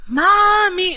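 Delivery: high, drawn-out Mommy; high childish Mommy; whiny child call. Retold or imitated. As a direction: call like a sleepless kid. Kid calling mom again.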